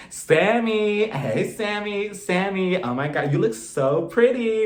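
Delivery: high, drawn-out squeal of welcome; gushing greeting. Retold or imitated. Mother greeting her son's prom date, mock gushing. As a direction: stretch each greeting in mock delight.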